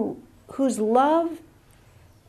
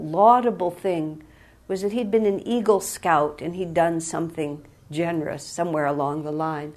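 ordinary talk

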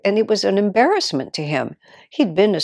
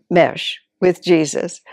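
normal talking voice

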